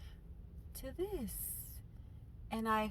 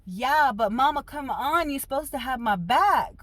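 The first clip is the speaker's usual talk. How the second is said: loud complaint; 6 semitones higher, 8 dB louder than usual. Retold or imitated. Scolding mom.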